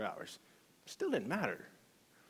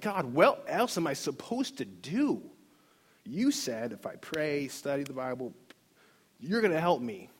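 slow, emphatic, raised-pitch plea to God; exasperated prayer; 3 semitones higher, 4 dB louder than usual. Retold or imitated. Man questioning God after Bible study fails, exasperated. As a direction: demand an answer, stretching the words. Frustrated prayer retold from confusion.

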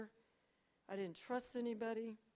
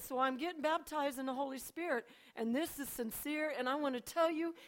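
raised, emphatic, bold declaration; firm, heartfelt announcement, pitched up; bold sincerity; 5 semitones higher, 5 dB louder than usual. Retold or imitated. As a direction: declare it boldly and in earnest. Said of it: "That was the boldest I ever talked".

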